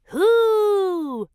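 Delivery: high loud answering call; echoing hoo. Acted. The canyon echo answers Goldie, loud high hoo. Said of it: "came another voice too".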